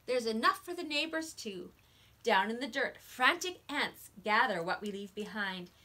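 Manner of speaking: put-on lively read-aloud for small children; expressive picture-book narration; story reading.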